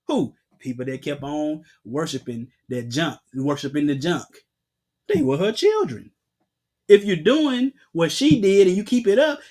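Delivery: ordinary talk, nothing marked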